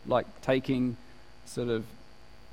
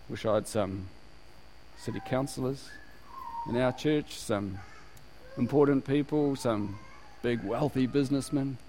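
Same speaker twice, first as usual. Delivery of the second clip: wishful churchgoer; 3 semitones lower, 6 dB quieter than usual. Acted. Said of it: "sometimes we, we go".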